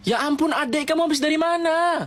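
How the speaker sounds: shouting down. Retold or imitated. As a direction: yell down to the little sister in relief. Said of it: "Gue teriak dari atas balkon".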